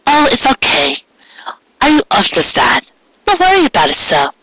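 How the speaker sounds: high, gentle forgiving voice; forgiving mare. Acted. Cupcake reassuring her sister, high and gentle. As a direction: raise it high and soothe her.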